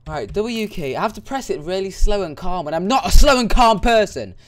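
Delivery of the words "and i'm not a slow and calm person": much louder, higher complaint; exasperated shout. Real, not acted. Gamer shouting that he is not a calm person. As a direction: blurt it out, fed up. Frustrated rant.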